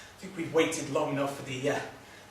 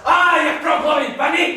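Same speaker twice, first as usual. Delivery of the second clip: high, loud fury; furious name-calling; shouted insult; 13 semitones higher, 12 dB louder than usual. Acted.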